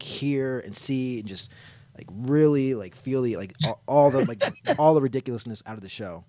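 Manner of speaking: rising into loud, thrilled gushing; excited. Real, not acted. Raving about the intimate show.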